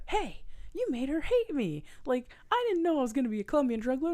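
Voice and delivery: high, fast whiny complaint; a high mock-whine, quoting the husband; whiny guy voice. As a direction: play the wronged husband, whining. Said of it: "and he's like".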